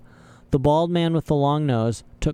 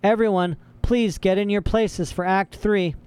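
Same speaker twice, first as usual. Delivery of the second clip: shouted; 8 semitones higher than usual. A narrator's reading. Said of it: "a man with a clipboard shouted".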